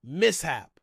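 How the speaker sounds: normal talking voice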